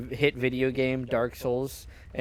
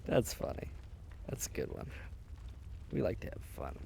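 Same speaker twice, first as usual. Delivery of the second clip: unvoiced deadpan whisper; 12 semitones lower, 17 dB quieter, faster than usual. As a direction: breathe it out flatly.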